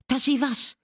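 high, loud demanding question; sharp exclamation. A narrator's reading. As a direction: call out the challenge. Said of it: "rief Catherine".

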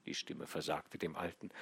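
ordinary delivery

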